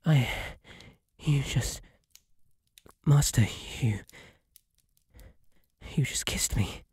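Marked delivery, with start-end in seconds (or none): 1.2-6.8 breathless whisper of disbelief; barely voiced, as if the kiss took her breath; stunned whisper. Stunned after being kissed.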